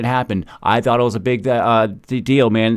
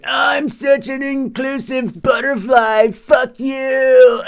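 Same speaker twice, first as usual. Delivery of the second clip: much higher, slow then shouted caricature; mock prissy critic; 13 semitones higher, much slower than usual. Mocking an inclusive butterfly cursing him, high mock voice. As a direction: drag out the sweetness, then bark the curse. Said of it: "and you're like".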